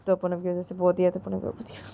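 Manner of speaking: plain reading voice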